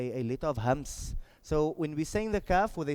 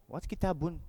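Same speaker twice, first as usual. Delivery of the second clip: usual speaking voice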